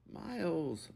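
high, drawn-out whine; whining. A narrator's reading. Lucas calling Miles, whining. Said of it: "Lucas whined".

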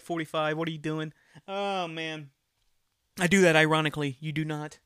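ordinary talk

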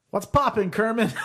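high, shouted hey; loud greeting. Retold or imitated. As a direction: yell it out like greeting a friend.

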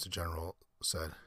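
plain reading voice

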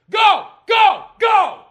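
high, very loud single-word bursts; shouted command. Real, not acted. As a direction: shout to chase it away. Chasing something off the table.

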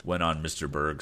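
ordinary delivery

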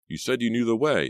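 high, defensive snap; snapped retort. A narrator's reading. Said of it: "Todd snapped".

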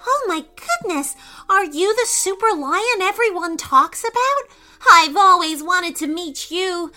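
put-on high, gushing, flattering boy. A narrator's reading. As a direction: lay the praise on thick. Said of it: "said Isaac with a flattering voice".

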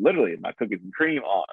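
usual speaking voice

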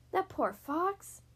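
plain reading voice

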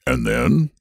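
prompting question. Acted. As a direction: nudge them on with a rising end.

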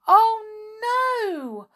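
high, loud wail of alarm. Acted. Molly realising her cat has gone, alarmed. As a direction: cry out high in dismay. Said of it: "said Molly in alarm".

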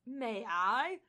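a hammed-up Australian ask; mock Australian accent. Friend cutting in as Thor with a mock Australian accent. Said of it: "still attempting it".

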